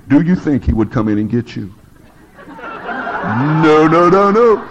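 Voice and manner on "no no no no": laughing no. Real, not acted. Mock denial, laughing.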